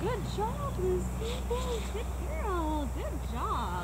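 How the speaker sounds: high, soft sing-song praise; dog praise voice. Real, not acted. Trainer praising her dog Lucy, high soft sing-song.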